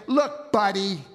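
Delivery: mildly confrontational "Look, buddy"; a touch of insolence; disrespectful address. Acted. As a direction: address him like some guy, not a father. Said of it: "it's a disrespectful address".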